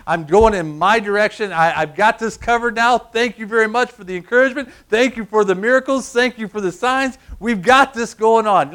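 a hint of a smug know-it-all; smug self-reliance. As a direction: brag like you've got it all handled. Cocky believer thanking God off.

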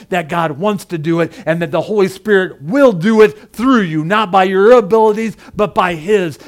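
full-force sermon climax; preaching. Real, not acted.

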